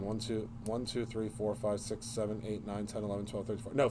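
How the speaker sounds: low, soft running count; counting to oneself. Real, not acted. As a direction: count under your breath while checking. Counting pins to self.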